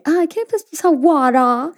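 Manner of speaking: put-on girl now asking in her American accent; American child accent. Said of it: "ya ahora en la actualidad que le dice". (acento americano de niña)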